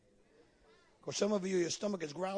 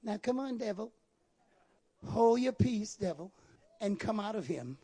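mock mild rebuke. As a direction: plead gently where a command is due. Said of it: "can you imagine?".

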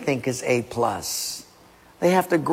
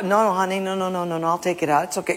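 wife backing down; 3 semitones higher, much faster than usual. Acted.

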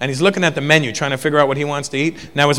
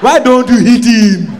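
mock Nigerian woman; 7 semitones higher, 6 dB louder, slower than usual. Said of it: "the Nigerian woman looks at me".

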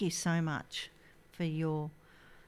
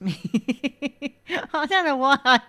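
laughing; 6 semitones higher, 10 dB louder than usual. Real, not acted. Host laughing at herself.

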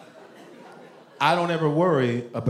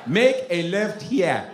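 put-on slow Nigerian-accented directions; drawn-out order in a Nigerian accent; Nigerian GPS voice; 3 semitones higher, much slower than usual. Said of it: "couldn't have a Nigerian on a G P S".

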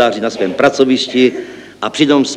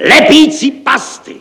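very high, loud, drawn-out shriek; hysterical shouting; 6 dB louder, slower than usual. Acted. Director losing his nerves at six, shouting hysterically. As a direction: explode in hysterical disbelief. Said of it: "volal hystericky".

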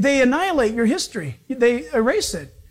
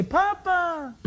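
put-on higher, softer childlike call of Papa; a soft, higher plea to a father; a child calling softly to its father; crying out to a father; 7 semitones higher, 5 dB quieter than usual. The Holy Spirit cries out.

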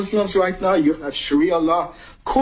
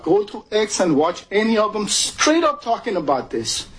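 ordinary delivery

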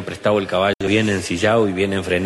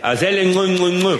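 urging the horse; 8 semitones higher than usual. Acted.

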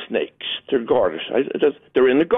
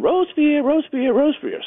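high sing-song calling of a lost duckling; mother duck calling; 12 semitones higher than usual. Retold or imitated. Mother duck calling her lost duckling, high sing-song. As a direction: call the name high like a worried mother duck. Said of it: "Mother's counting".

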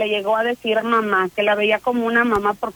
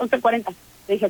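normal talking voice

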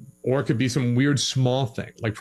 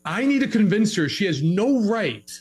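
mock stubborn husband; 8 semitones higher than usual. Acted husband's inner voice in fight.

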